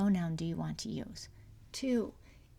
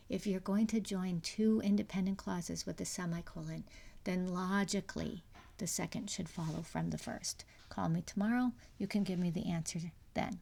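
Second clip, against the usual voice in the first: ordinary delivery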